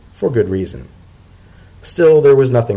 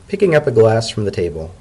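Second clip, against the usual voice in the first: ordinary delivery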